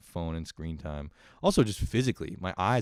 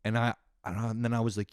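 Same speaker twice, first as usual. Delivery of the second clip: usual speaking voice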